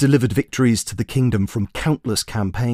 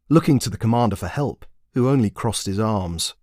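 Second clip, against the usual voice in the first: usual speaking voice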